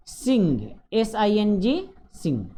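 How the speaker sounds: "रिंग" said slowly with a clear final ng, then spelled letter by letter; pronunciation drill. Shown as a demonstration. Teacher modelling "रिंग" for pupils, slow and clear. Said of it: "यहाँ पे pronounce करना सीखना है".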